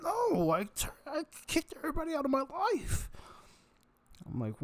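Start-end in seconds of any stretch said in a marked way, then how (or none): 0.0-3.1 loud, very high hammed-up wail; mock crying outburst. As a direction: imitate a man bawling at top pitch. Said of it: "he just started crying in his hands".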